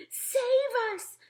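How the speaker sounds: high, loud scream for help; terrified scream. A narrator's reading. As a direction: scream out in nightmare panic. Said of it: "screamed the flesh lump eater".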